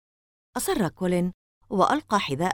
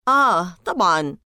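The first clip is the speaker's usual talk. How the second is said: sarcastic; 6 dB louder than usual. Acted. Colin mocking Larry's warning, loud sarcasm. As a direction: scoff it off with mock agreement. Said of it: "قال كولين بسخرية".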